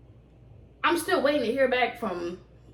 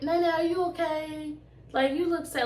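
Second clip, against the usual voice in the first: concerned papa; 7 semitones higher, 4 dB quieter than usual. Retold or imitated. Papa asking Nene at Thanksgiving, soft high concern. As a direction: lightly voice a worried grandpa. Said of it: "my papa was like".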